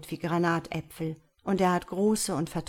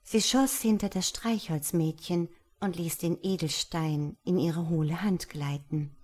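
ordinary delivery

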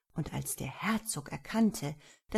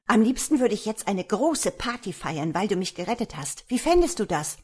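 high, loud, eager little voice; excited dwarf; 4 semitones higher, 6 dB louder than usual. A narrator's reading. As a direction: pitch a party idea eagerly. Excited dwarf proposes a party.

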